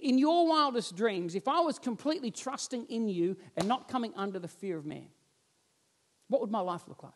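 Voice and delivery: ordinary delivery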